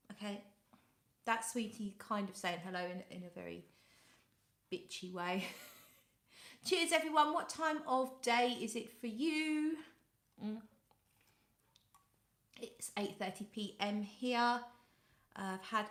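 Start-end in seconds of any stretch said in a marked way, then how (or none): none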